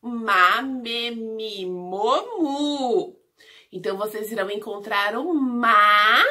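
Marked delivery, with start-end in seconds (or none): 0.0-3.2 "Ma, me, mi, mo, mu" recited as a linked series; syllable drill. Shown as a demonstration. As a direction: chant through "Ma, me, mi, mo, mu", lifting at "mu". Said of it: "Nós vimos as sílabas agora".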